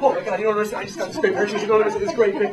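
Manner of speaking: high, loud, breathless gushing; a hyped-up sister-in-law raving over a great purchase. Retold or imitated. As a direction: rave about the purchase, laughing. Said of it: "she's like".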